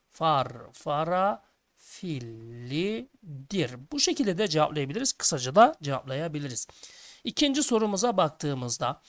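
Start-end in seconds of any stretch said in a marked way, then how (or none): 0.1-3.8 very slow, drawn-out syllables; slow dictation. Shown as a demonstration. Teacher giving pupils the full answer, slow dictation. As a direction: stretch out each word as if dictating. Spelling out the long written answer.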